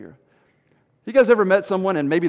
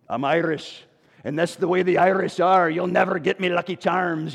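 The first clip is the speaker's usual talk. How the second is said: jokey attempt at an Irish accent. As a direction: put on your best stage Irish. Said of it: "that's my best, uh, accent".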